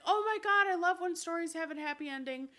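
high, gushing and fast; excited teacher. Retold or imitated. Teacher gushing over a happy ending. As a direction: gush like a delighted teacher. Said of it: "she was like".

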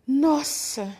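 a snake's startled cry; startled exclamation. Acted.

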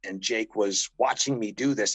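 usual speaking voice

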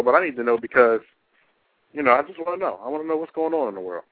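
laid-back DJ asking listeners to call; radio host call-in. Acted. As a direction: talk to the audience like a radio host.

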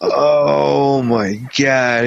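slow, drawn-out "oh my God"; long-drawn scandalized exclamation; shocked reaction. Real, not acted. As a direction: stretch every word in real disbelief. Listener reacts to shocking story.

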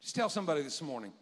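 normal talking voice

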